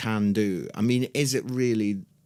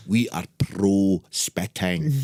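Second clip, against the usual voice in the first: put-on Scottish accent; 5 semitones lower, slower than usual. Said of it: "strong Scottish accent".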